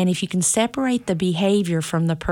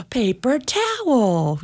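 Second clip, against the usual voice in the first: delighted praise; 7 semitones higher, much slower than usual. Retold or imitated. Mother praising child's idea.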